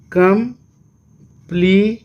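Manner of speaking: very slow "complete" as कं, plea, ट; long pauses inside complete, final ट sounded alone; syllable by syllable. Shown as a demonstration. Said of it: "three syllable". ("Complete" बहुत धीरे, तीन टुकड़ों में)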